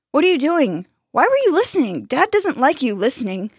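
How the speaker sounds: high-pitched, agitated questioning; big brother telling off little sister; scolding. A narrator's reading.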